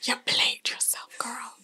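teasing whisper; hushed playful jab. Real, not acted. Teasing aside.

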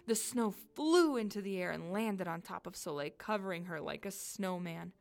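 ordinary delivery, nothing marked

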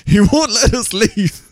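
laughing through the words, slow; half-laughing disbelief. Real, not acted. Joe laughing as he retells the trapped scene.

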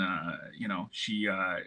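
ordinary delivery

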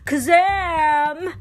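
magic cry. A narrator's reading. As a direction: call the spell out. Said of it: "cried the genie".

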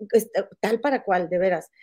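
ordinary talk, nothing marked

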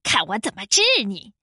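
low, fast, menacing threat; growled threat without much volume; roaring wolf. A narrator's reading. Wolf pounces on the crow, mildly roaring. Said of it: "帅狼吼叫着".

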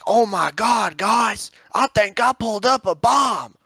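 mocking country magnet fisher, loud-voiced and high; country accent. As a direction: imitate an excited country guy. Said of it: "country or".

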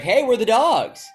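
high, loud mock announcement; calling out. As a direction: shout it out to get the city's attention. Mimics a newcomer team announcing itself.

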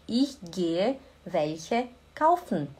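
slow, clear Ich gehe welche kaufen; slow model. Shown as a demonstration. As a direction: drill it word by word. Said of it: "slow repeat for learners".

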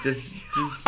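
squeaky, high embarrassed giggle through the words; flustered laughing protest. Real, not acted. Pushed to do an accent.

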